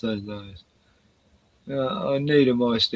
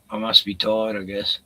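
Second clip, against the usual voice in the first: ordinary talk, nothing marked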